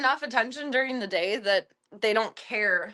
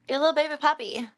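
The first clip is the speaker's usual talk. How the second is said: higher, louder, fast gushing coo; fast squeaky pet talk; baby talk; 6 semitones higher, 5 dB louder, faster than usual. Pretend. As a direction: gush over the little puppy.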